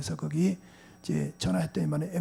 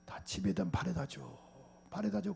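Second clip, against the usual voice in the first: soft, weak plea from a sick man; faint dying plea; 13 dB quieter than usual. Retold or imitated. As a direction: barely voice the dying brother's wish. Dying brother's weak request. (죽어가는 형의 힘없는 부탁)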